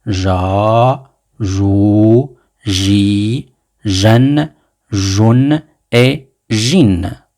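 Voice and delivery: stretched syllables and a slow ending; slow, careful drill; teacher repetition. Shown as a demonstration. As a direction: model each sound slowly. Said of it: "Je répète".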